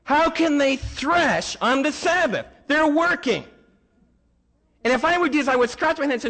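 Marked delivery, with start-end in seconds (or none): 0.0-3.4 high, accusing Pharisee voice, slowing on the second question; pitched-up mock outrage at the disciples; indignant Pharisee. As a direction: play the scandalised religious critic. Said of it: "the Pharisees come up and say".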